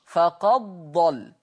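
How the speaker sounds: "فقد ضل" بإدغام الدال في الضاد ببطء; تُنطق "فقد ضل" بلا أثر للدال مع تشديد الضاد; فقد ضل بالإدغام. Shown as a demonstration. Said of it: "يقرأ ورش". (تلاوة بطيئة لـ"فقد ضل" بإدغام الدال)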